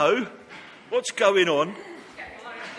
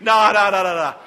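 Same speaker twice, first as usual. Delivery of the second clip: loud, rapid-fire protest; calling a halt; 7 dB louder than usual. Real, not acted. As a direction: cut in loudly to stop them. Calling halt to overdone water throwing.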